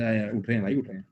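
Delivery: a low, quiet, half-asleep mumble; sleepy mumble. Retold or imitated. Teacher imitates his own groggy reply to mom. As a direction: groan it out like you were just woken.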